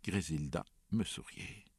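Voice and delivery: ordinary delivery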